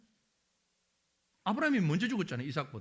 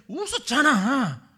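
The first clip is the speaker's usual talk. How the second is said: slow, louder, laughing "웃었잖아"; slow teasing; 4 semitones higher, 10 dB louder, slower than usual. Acted. Preacher as God drawing out the accusation, slow and laughing. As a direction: drag it out with a chuckle. God insists again, slowly.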